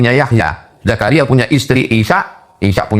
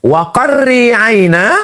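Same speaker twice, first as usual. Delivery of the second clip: slow, higher chanted Quran verse; Quran recitation; 13 semitones higher, slower than usual. Acted. (tilawah Quran yang lambat dan bernada)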